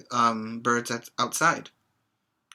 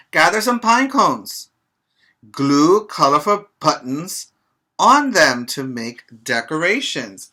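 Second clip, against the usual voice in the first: bright, louder storybook read-aloud; reading to children; 3 semitones higher, 8 dB louder than usual. Acted. Teacher reading a picture book to her pre-K class, lively read-aloud.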